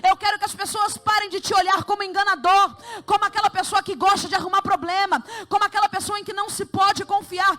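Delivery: loud, high proclamation in God's voice; preaching at full force. Acted. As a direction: pour it out over the church.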